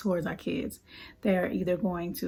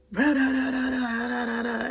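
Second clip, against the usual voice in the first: higher, hammed-up nagging babble; mock ranting; 4 semitones higher than usual. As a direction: parody a parent barking at the kid. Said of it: "Instead of me".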